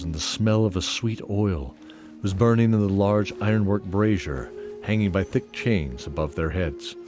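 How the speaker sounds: ordinary talk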